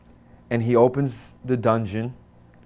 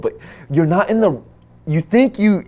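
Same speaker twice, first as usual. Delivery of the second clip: ordinary delivery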